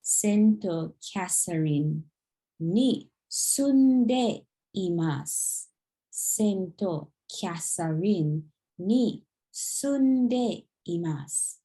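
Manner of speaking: very slow, deliberate Sento Catherine ni sunde imasu; slow demonstration. Shown as a demonstration. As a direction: draw each syllable out for the learner. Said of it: "So you'd say".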